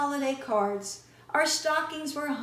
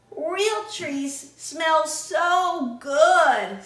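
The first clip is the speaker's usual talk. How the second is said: loud, slow, drawn-out relish; savouring; 4 semitones higher, 7 dB louder, much slower than usual. A narrator's reading. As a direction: stretch it out, relishing every word. Brother savours smell of trees.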